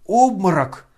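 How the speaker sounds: loud high alarmed cry; shouting. A narrator's reading. Guests shouting that Ganya fainted. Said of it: "закричали кругом".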